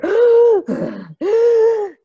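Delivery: sharp high loud gasp as if winded; loud breathless gasp; demo gasp for breath. Shown as a demonstration. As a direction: gasp like you just sprinted. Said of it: "you're out of breath".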